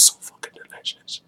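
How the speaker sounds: voiceless, softened aside on the swearing; whispered under the breath; hushed whisper. Real, not acted. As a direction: whisper it softly. Swear word hushed, then restated.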